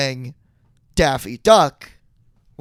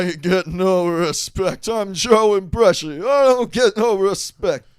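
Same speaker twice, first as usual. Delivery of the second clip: raised, whining mock Dangerfield; Rodney Dangerfield impression; 5 semitones higher, 3 dB louder than usual. Goofing for a friend, hammed-up Dangerfield complaint. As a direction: complain like a bad Rodney doing Pesci. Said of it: "You're doing Rodney Dangerfield doing Joe Pesci".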